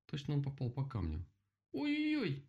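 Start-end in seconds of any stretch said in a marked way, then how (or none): 1.7-2.4 high-pitched yelp of pain; pained exclamation. A narrator's reading. Rabbit who kicked a stone-hard turtle, pained high yelp. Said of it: "воскликнул кролик".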